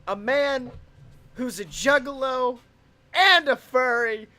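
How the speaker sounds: ringmaster. Pretend. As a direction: hype the big reveal. Ringmaster builds to shout.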